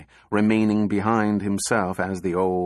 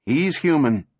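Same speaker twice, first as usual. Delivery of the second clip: higher, lightly projected call; called over shoulder; 5 semitones higher, 3 dB louder than usual. A narrator's reading. Doctor to a reporter as she drives off, calling over her shoulder. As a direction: toss it back as a parting call. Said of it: "called over her shoulder".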